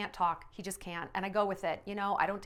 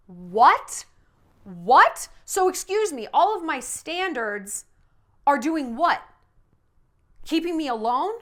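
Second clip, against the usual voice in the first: loud, high, incredulous What? What?; mock outrage; 4 semitones higher, 7 dB louder, slower than usual. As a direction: play the stunned, offended friend, big and loud. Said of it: "and you're like".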